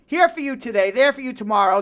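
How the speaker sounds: usual speaking voice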